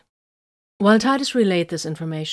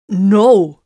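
sharp, much louder cry; exclaiming; 3 semitones higher, 13 dB louder than usual. A narrator's reading. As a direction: blurt it out loud. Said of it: "Titus exclaimed".

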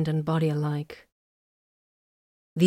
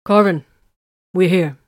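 louder call to a distance; calling to Corvin across the room; 8 dB louder than usual. A narrator's reading. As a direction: project to someone far off. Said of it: "she called out".

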